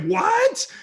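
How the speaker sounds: a very high, loud disbelieving yelp; shocked what. Retold or imitated. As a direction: blurt it out in total disbelief. Shocked by two-hour walk.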